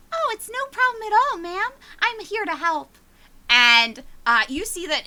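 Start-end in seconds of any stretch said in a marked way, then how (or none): 0.1-3.0 put-on high, eager little boy; sugary child, overly helpful; sweet kid voice. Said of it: "Jimothy's like".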